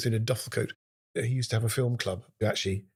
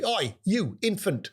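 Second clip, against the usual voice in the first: high Northern Bradford teacher barking Oi, you, infant; Northern Bradford schoolmaster calling out a pupil; Northern Bradford accent; 9 semitones higher, slower than usual. Retold or imitated. French teacher scolding a pupil, in a high Northern Bradford accent. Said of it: "He had a Northern Sydney Bradford accent".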